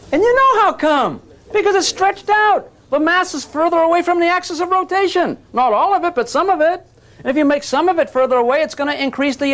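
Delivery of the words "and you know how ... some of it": much higher, driving the point home; pitched-up eager explaining; emphatic. Real, not acted. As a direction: push it up high and insistent. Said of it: "emphatic explanation".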